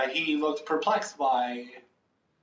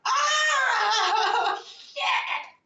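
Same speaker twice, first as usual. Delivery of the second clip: high agonized shriek; burning scream; 5 dB louder than usual. A narrator's reading. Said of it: "you hear him scream in agony".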